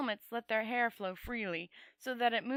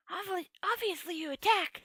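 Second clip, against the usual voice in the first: high, scornful voice of a talking sword; a high sneering sword scoffing at the wizard's question; 9 semitones higher than usual. A narrator's reading. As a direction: sneer it down at him, pitched high. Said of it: "said Cringe scornfully".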